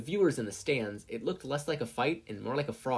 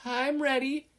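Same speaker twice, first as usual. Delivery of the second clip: very high tense squeak; frightened, stuttering; nervous stutter; 5 dB louder, slower than usual. A narrator's reading. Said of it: "Habuko stuttered".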